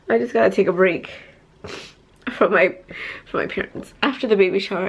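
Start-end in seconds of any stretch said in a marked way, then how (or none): none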